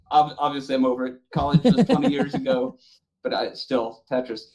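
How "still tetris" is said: ordinary delivery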